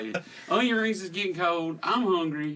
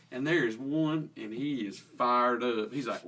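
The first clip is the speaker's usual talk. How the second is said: ordinary talk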